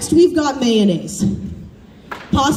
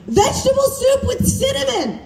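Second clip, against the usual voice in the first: high, laughing near-shout of disbelief; outraged punchline; 11 semitones higher than usual. Real, not acted. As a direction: blurt the answer like it's absurd. Punchline about Thanksgiving meal.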